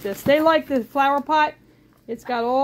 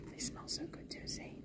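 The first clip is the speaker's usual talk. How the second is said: soft hushed whisper; 22 dB quieter than usual. Real, not acted. Owner whispers to the bird about cinnamon sticks. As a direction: breathe it out secretly.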